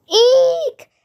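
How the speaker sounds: high squealing eek, loud; girls' shriek. Acted. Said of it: "went all the girls".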